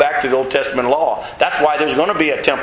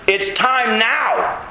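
slow emphasis; 5 semitones higher, much slower than usual. Acted. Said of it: "emphatic slowed phrase".

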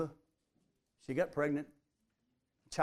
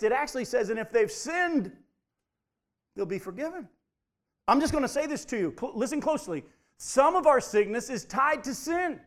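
higher, louder preaching voice; fervent preaching; 5 semitones higher, 4 dB louder than usual. Acted. Pastor urging the church about sickness and sin, fervent. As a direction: press the point home to the congregation.

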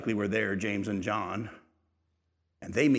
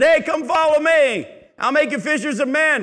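mock yell; 11 dB louder than usual. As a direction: holler the call the way we always imagined it. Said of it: "We always read it that he yelled it".

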